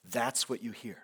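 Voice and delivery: ordinary delivery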